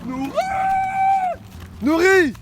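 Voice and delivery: loud high shout across the lake; calling out. Real, not acted. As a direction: yell a name to someone far away. Appelle un ami perdu. (appel crié au loin)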